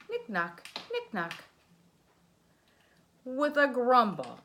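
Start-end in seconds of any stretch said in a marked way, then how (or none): none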